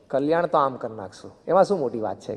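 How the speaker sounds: easy offhand reply, no weight on Kalyan; casual, effortless. Retold or imitated. Guru reassuring a disciple about salvation, offhand and easy. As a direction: toss it off as if it's nothing. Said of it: "સાહજિક".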